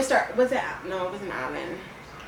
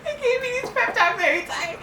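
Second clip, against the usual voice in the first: high, laughing words; 12 semitones higher than usual. Real, not acted. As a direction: giggle through the line. Laughing through the story.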